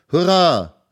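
put-on high, loud children's hurrah; joyful loud cheer; cheering shout. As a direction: shout it out like a crowd of kids. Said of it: "riefen die Kinder".

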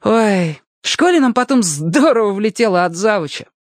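put-on high, animated boy's storytelling; excited boy. Boy tells Alyoshka about the weather-vane prank, excited high voice. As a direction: revel in telling the mischief, pitched high.

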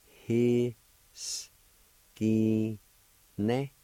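He-s-ki-Ne in slow, separated sounds, each held; syllable by syllable. Shown as a demonstration. Teacher showing learners how it's pronounced, syllable by syllable. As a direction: sound out "He-s-ki-. Ne." piece by piece with gaps. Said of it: "It's pronounced like this".

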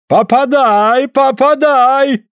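very high, stretched cries; yelled urging. A narrator's reading. Suль goads Andrei over the anvil, yelling. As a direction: yell it high and drag it out. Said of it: "орёт Суль".

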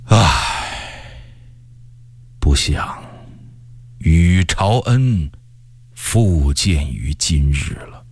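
low, very slow, breath-heavy musing; hushed, drawn-out inner regret; sighing lament. A narrator's reading. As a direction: sigh it out as a private lament. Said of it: "但是心里却感慨地说".

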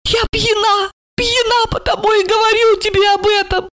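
high-pitched tipsy insistence; excitable tipsy woman; drunk woman confessing. A narrator's reading. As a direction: declare it in a high, earnest drunken voice.

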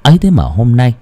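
plain reading voice

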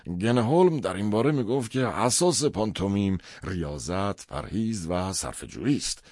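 animated, defensive character voice; nervous father. A narrator's reading. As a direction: lecture as a rattled father justifying himself. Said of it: "سریعتر از آنچه به ظاهر".

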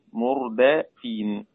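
very slow "مردفين" with a clear fatha, mur-DA-fin; teaching demo of "مردفين", slow and exact; open dal. Shown as a demonstration. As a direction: draw out "مردفين" syllable by syllable, dal opened. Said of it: "نافع بفتح الدال".

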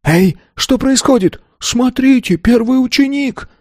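very high, startled calls; alarmed onlookers. A narrator's reading. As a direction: call out from the crowd in alarm. (встревоженные высокие выкрики)

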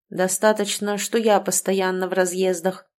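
ordinary delivery

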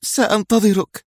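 high-pitched, emotional woman's promise; trembling voice. A narrator's reading. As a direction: say it choked with feeling. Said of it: "قالت بصوت متهدج".

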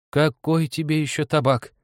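high-pitched scolding retort; lively objection. A narrator's reading. Old woman refuses husband tobacco, lively high retort. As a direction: protest briskly in an old woman's voice. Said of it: "живо возразила старуха".

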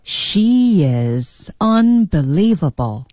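"She is" very drawn out, then "unbelievable" deliberately; slow emphatic repeat. Shown as a demonstration. Co-host trying the line again, very slow and emphatic. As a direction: linger on every word of "She is unbelievable". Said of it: "就是这么说的".

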